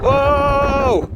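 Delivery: a sudden high yelp as the wind hits; startled whoa. Real, not acted. Detectorist hit by freezing wind, high startled whoa. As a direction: caught off guard by a blast of cold wind.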